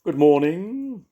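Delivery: high, loud and slow owl greeting. A narrator's reading. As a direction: sing it out like a grand owl. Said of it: "It was the owl".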